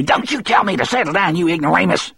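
high-pitched outraged voice; indignant yell. A narrator's reading. Percy defying the guard who restrains him, indignant high yell. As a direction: snap back high and offended. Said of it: "Percy yelled".

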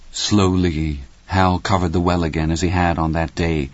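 ordinary delivery

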